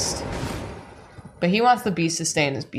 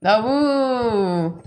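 higher, louder howling dog man; wolf voice; 7 semitones higher, 3 dB louder, slower than usual. Pretend. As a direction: howl then talk like a dog.

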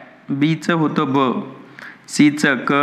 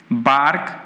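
ordinary delivery

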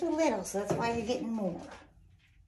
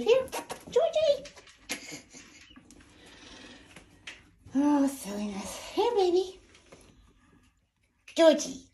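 high bright calling coo; 7 semitones higher, 4 dB louder than usual. Real, not acted. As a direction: call him over sweetly.

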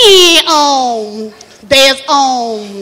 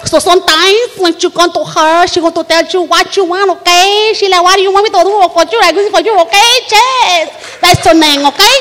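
put-on Puerto Rican accent, sassy; Juanita Chiquita; 2 semitones higher than usual. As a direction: talk like a Puerto Rican woman checking "okay?". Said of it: "from Puerto Rico".

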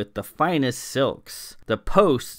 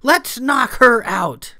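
loud urging; 13 semitones higher, 8 dB louder, slower than usual. A narrator's reading. Clara urging violence.

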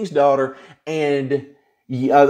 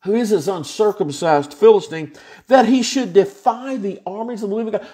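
ordinary delivery

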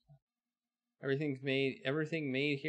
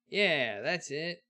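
a hint of a folksy frontier blacksmith, higher and louder at the start; old western blacksmith; 5 semitones higher, 9 dB louder, slower than usual. Busy blacksmith calls to the newcomer, folksy drawl. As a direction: hail the stranger like a busy old smith.

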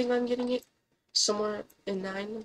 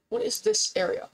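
high surprised squeal; 13 semitones higher than usual. Real, not acted. Startled by strange game area.

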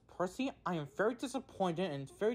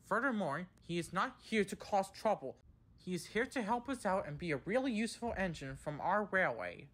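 higher-pitched, insistent telling-off; firm lecture; 6 semitones higher, 4 dB louder than usual. A narrator's reading. He sends the two engines to the sheds, firmly. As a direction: drive the punishment home firmly. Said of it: "he added firmly".

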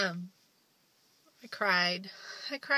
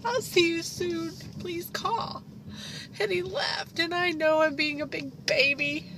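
high, tear-choked voice breaking through sobs; real crying, fighting to get the words out; 10 semitones higher, 5 dB louder than usual. Real, not acted. Mother sobbing after her son leaves for boot camp, voice breaking. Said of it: "God, here I go again".

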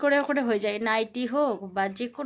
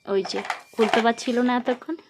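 put-on bright, loud sing-song quizzing of a toddler; loud child-directed teaching; 4 semitones higher, 7 dB louder than usual. Mother quizzing her toddler on letters, in a loud sing-song. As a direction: coax the little one through letters and numbers, loud and eager.

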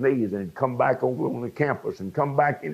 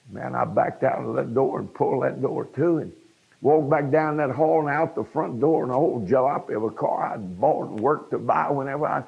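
usual speaking voice

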